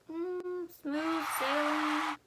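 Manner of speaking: high, drawn-out glide on the words; mock breezy confidence. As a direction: coast through it, slowly and high. Mocks an instant easy fix.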